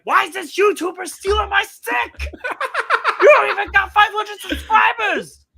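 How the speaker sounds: high, loud mocking rant with laughs; Mad Dog impression. Mad Dog complaining that a YouTuber stole his shtick, high and loud. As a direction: sneer at the tiny YouTuber. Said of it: "Mad Dog Russo and him be like".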